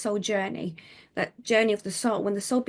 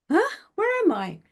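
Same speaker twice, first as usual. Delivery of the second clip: puzzled; 11 semitones higher, 3 dB louder than usual. Retold or imitated. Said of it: "standing there going".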